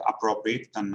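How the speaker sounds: normal talking voice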